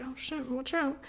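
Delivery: scared hush. Pretend. Moviegoers cowering after a mom's shush, hushed. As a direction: murmur like a scolded crowd. Audience reacts to mom.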